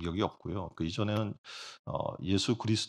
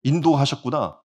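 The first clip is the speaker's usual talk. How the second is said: grateful realization; 5 semitones higher, 8 dB louder than usual. Retold or imitated. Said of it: "하나님의 은혜를 생각할 때 감격이 넘치는".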